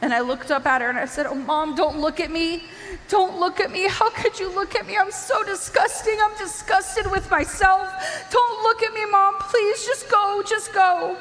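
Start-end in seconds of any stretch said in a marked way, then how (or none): 1.2-11.2 high-pitched, anguished tearful pleading; crying. Retold or imitated. Ashamed daughter begging mom not to look at her, crying. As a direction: re-enact sobbing shame, climbing higher as you plead. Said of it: "I'm crying".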